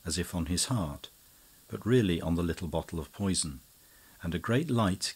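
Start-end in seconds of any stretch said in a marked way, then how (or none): none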